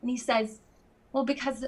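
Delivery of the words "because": ordinary talk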